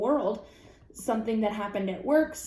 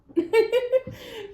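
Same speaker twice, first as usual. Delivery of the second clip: high burst of laughter; 13 semitones higher, 4 dB louder than usual. Real, not acted. Laughs at own line.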